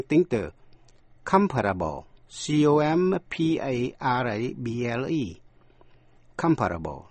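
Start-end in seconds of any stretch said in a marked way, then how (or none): 1.2-2.0 comparable with the beat on "com"; stress on first syllable. Shown as a demonstration. Teacher introduces the adjective comparable, first syllable stressed. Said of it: "nhấn vần đầu". 6.4-7.0 comparable accented on "com", then falling; stress on first syllable. Shown as a demonstration. Teacher shows learners comparable with stress on the opening syllable. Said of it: "nhấn vần đầu".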